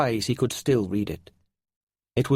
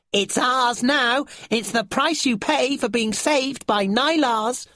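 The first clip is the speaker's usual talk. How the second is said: high, slow smug creature voice; 13 semitones higher, slower than usual. A narrator's reading. Brown creature claiming the armlet from Finn, high slow gloating. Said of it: "looked down at Finn and said".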